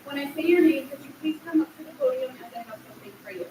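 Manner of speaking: ordinary delivery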